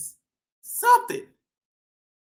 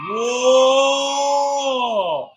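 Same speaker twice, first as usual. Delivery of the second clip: drawn-out amazed whoa; long mock whoa. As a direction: stretch it out for the kids.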